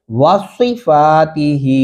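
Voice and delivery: वासीफातिही with heavy "swee" and long vowels; slow model वासीफातिही, emphatic S; rounded Saad. Shown as a demonstration. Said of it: "होंठों की शक्ल गोल".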